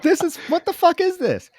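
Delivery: high, squeaky shocked outburst; shocked disbelief. Retold or imitated. Host reliving the creepy art maze, high shocked squeal. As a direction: shriek it in stunned confusion.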